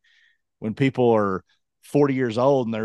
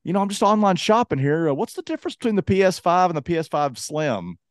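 higher, mimicking a friend's casual question; Colin; 6 semitones higher than usual. Retelling a friend's console question, higher imitating voice. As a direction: pitch it up as the asking friend. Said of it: "And, uh, he goes".

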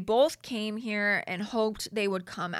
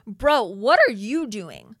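loud, slow, disbelieving bro, what; loud exasperated disbelief; 8 dB louder, slower than usual. Real, not acted. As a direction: call him out in disbelief. Exasperated at contestant's message.

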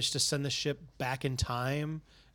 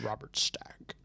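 whisper; 3 semitones lower, 5 dB quieter, much slower than usual. Retold or imitated. Naming the show's host.

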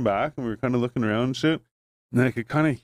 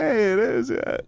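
excited friend; 11 semitones higher, slower than usual. Retold or imitated. Friend excited it's him.

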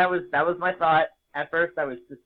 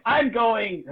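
high, loud burst of joy; a retold dream thrill, pitched up; excited exclaim; 8 semitones higher, 5 dB louder than usual. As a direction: blurt it out thrilled.

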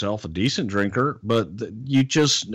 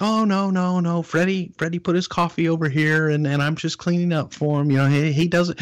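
high, lightly voiced polite Japanese wife; Miyako's voice; 7 semitones higher than usual. Miyako telling the wife she is just cleaning up. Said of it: "And she's like".

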